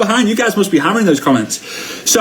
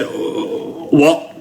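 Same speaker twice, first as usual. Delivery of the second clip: high whispered what; confused whisper to dad; baffled what; 10 semitones higher, 4 dB quieter than usual. Retold or imitated. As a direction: ask in disbelief. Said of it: "I said".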